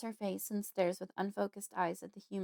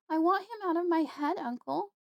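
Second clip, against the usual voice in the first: put-on distressed young woman; 10 semitones higher, 4 dB louder than usual.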